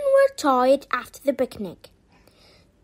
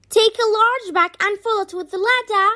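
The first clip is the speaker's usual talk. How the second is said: brisk, higher-pitched command; ordering; 6 semitones higher, 4 dB louder, faster than usual. A narrator's reading. The queen sets Chip to work, fast firm order. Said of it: "she ordered".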